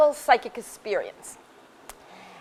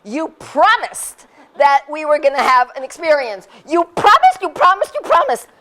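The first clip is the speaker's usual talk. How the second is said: higher, louder, building into a laughing plea; pleading complaint; 5 semitones higher, 5 dB louder than usual. Retold or imitated. She complains to the teacher about the promised experience, rising pleading whine. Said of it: "and I said".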